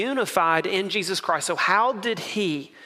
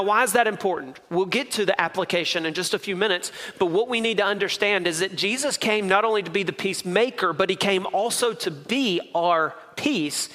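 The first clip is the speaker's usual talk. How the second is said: usual speaking voice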